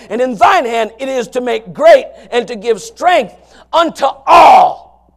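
loud, slow, preached reading of the verse; fervent scripture reading. Acted. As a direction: proclaim the verse to the congregation.

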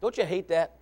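usual speaking voice

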